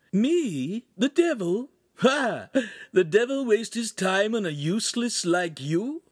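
very high, incredulous mockery; loud scornful demon imp; scoffing. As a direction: echo it with theatrical disbelief.

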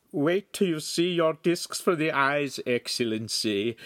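high nasal salesman's voice; high-pitched nasal. A narrator's reading. Eager salesman to his excellency about new spectacles, high-pitched nasal voice. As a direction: pitch it high, through the nose. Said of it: "said in his high-pitched nasal voice".

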